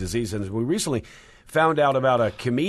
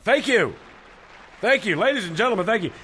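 hyped club announcer; 8 semitones higher than usual. Acted. Club announcer introducing comedian.

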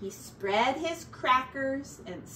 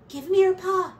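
higher, louder urgent call; Ratty's voice; 8 semitones higher, 9 dB louder, faster than usual. A narrator's reading. Ratty pulls Mouse to safety, urgent and high. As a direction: reach down and call him up quick.

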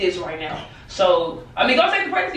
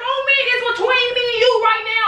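raised, heated accusations; angry outburst; 7 semitones higher than usual. Real, not acted. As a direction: let the anger burst out high. Caught out by pregnancy prank.

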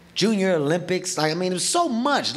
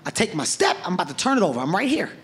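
usual speaking voice

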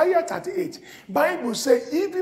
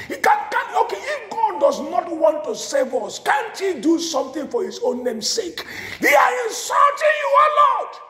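pleading cry to God; 6 semitones higher than usual. Acted. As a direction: cry it up to heaven. Voicing Hezekiah's prayer, climax.

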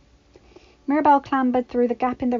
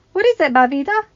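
delighted grandmother; 7 semitones higher, 9 dB louder than usual. A narrator's reading. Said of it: "delighted at her granddaughter's excitement".

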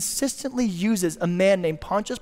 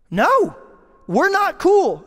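higher, louder, indignant no; mock angry shout; 10 semitones higher, 6 dB louder, slower than usual. Victim shouting at his family's killer on the front lawn, indignant. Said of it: "acted quarrel on the lawn".

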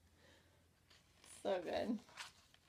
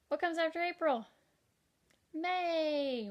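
bright quiz call; 6 semitones higher, 6 dB louder than usual. Acted. Teacher quizzing kids, answering brightly.

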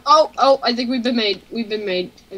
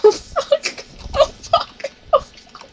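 scared cursing; 14 semitones higher, much slower than usual. Real, not acted. Horde approaching.

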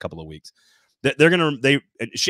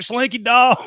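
high, loud mock kid scream; imitating daughter's scream; 12 semitones higher, 7 dB louder, slower than usual. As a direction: scream it like a child on a roller coaster. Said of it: "just starts screaming on the roller coaster".

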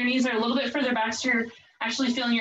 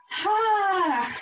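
relieved laugh; 8 semitones higher than usual. Real, not acted. Laugh of relief after set.

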